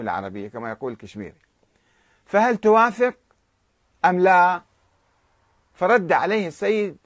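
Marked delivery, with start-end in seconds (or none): 2.3-4.6 loud, sharp angry question; retold angry ultimatum, louder; angry demand. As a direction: demand an answer in anger. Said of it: "وهو بعصبية".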